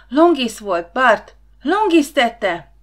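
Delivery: loud, high cry of alarm; screaming accusation. A narrator's reading. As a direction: shout it out, rising to a slow scream. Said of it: "kiáltotta, sikoltotta Nóra".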